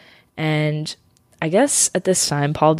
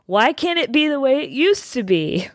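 shouting capitalized words; 9 semitones higher than usual. Retold or imitated. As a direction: read it like an all-caps telegram. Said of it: "telegram saying in all caps".

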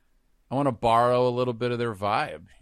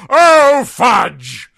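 much higher, loud theatrical outburst; Patrick Stewart fudge; 18 dB louder than usual. Shown as a demonstration. One-man Christmas Story exclamation, a loud hammed-up outburst. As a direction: blurt it like a startled stage actor. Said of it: "one-man A Christmas Story".

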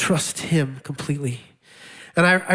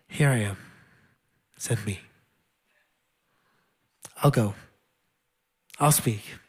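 quiet surrender; 8 semitones lower, 6 dB quieter than usual. Acted. Prophet's answer, read softly.

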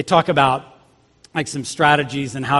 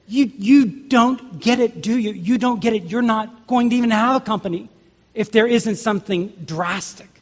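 higher, emphatic exasperated consultant; exasperated disbelief; 7 semitones higher than usual. Acted. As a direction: spell it out, appalled they still don't understand. Consultant unimpressed by trivial changes.

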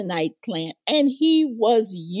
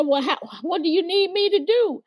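higher, fast, lightly voiced eager lady; eager older lady; 10 semitones higher, faster than usual. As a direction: ask quickly, ready to help. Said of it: "And she said".

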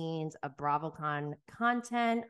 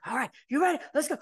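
raised, louder rallying call; imitated hyping up; 7 semitones higher, 5 dB louder, much faster than usual. Instructor running in with recruits, hyping them up. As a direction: pump up the team before they run. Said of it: "gets them all hyped".

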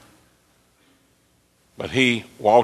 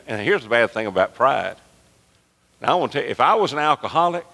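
ordinary talk, nothing marked

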